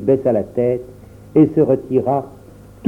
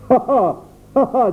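rire moqueur; 10 semitones higher than usual. A narrator's reading. Le père se moque des enfants.

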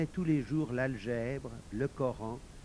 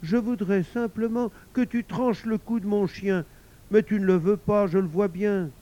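higher, louder demanding wife voice; reproachful wife; 8 semitones higher, 7 dB louder than usual. Acted. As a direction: push him with wounded reproach.

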